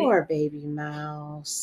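soft, slow and pitying. Real, not acted. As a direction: say it softly, with pity. Pitying a dead mouse.

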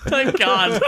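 normal talking voice

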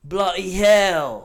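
loud, slow mock-British curse; exaggerated British; British hooligan accent. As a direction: draw it out like a British soccer fan. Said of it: "like he's a hooligan".

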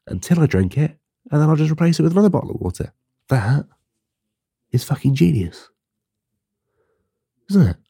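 rising high and fast with pride; gleeful self-congratulation; excited boast. Real, not acted. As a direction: sell it like a genius idea. Proudly reveals his water solution.

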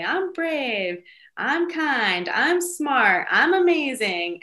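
put-on high, chanted child's affirmations; little son's affirmations. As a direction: declare each one proudly like a small boy. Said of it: "And he'll say".

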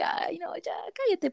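high unvoiced whisper telling him to shut up; retold whispered shush. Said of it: "pero dije". (susurro agudo y harto)

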